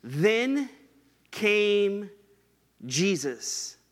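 Then, came, Jesus, each word set apart by long pauses; slow word-by-word emphasis; emphasis on every word. Shown as a demonstration. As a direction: space out Then, came, Jesus with weighty breaks. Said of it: "it kind of has this emphasis".

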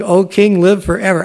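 a lightly played, glad call up to the king; cheerful greeting. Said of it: "Daniel said to the king".

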